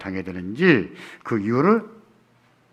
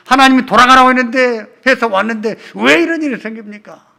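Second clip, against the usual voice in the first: peak shout, much higher and louder; desperate cry; 10 semitones higher, 10 dB louder than usual. Acted. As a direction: wail it at the top of the voice. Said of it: "우리 뭐라고 합니까?".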